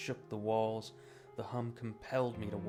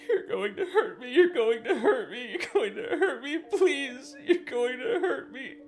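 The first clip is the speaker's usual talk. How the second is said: high wailing, laughing through sobs; laugh-cry high and frantic; hysterical sobbing laugh; 14 dB louder than usual. Acted. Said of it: "He continued to sob and rock".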